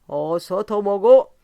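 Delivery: put-on loud, slow drunken bellow; a slow drunken shout at his friend; drunken yell. A narrator's reading. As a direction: roar it out, half drunk. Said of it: "취한 이는 부르짖었다".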